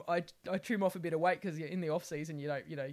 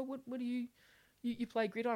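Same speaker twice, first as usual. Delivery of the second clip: high, puzzled voice of someone who's heard of the game; baffled outsider; 7 semitones higher than usual. Retold or imitated. Imitating a confused acquaintance, high and puzzled. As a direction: play a confused non-fan asking about gridiron. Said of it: "And they go".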